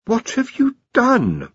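slow, high aghast question; horrified. A narrator's reading. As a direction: gasp it out in horror. Horrified Ponder.

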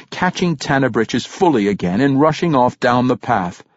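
ordinary delivery

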